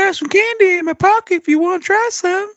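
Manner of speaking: very high, creepy put-on voice; a sleazy creep luring someone; creepy pervert voice. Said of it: "Why did Josh turn me into a pervert".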